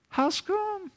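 high, soft, plaintive How's come; whiny complaint. Retold or imitated. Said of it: "Is that real words?".

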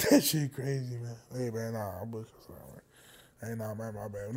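very low and hushed; sheepish apology. Real, not acted. Host apologizing for a dark joke, sheepishly quiet. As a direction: back off the joke almost under your breath.

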